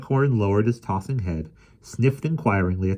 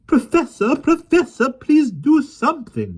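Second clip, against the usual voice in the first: much higher, loud, slow fussing lady; rising shrill plea; 8 dB louder, slower than usual. A narrator's reading. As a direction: flutter and plead for help. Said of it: "Miss Lark's voice rose".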